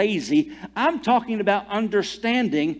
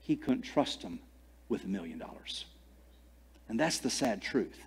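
much softer, lower sorrowful close; sad quiet; 6 semitones lower, 11 dB quieter than usual. Acted. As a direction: let it fall to a hush. Sermon point fading.

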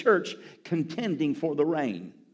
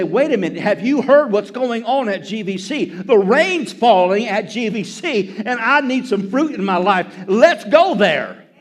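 higher, louder excited exclamation, building; excited townspeople; 3 semitones higher, 7 dB louder than usual. Acted. Townspeople hearing the rain's falling at church, excitedly. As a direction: spread the news with rising excitement.

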